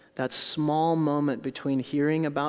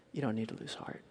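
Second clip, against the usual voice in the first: hushed reassurance; 3 semitones lower, 11 dB quieter than usual. Real, not acted.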